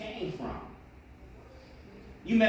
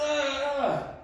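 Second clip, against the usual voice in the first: imitated fearful groan; 5 semitones higher than usual. Wife scared by cars' sound.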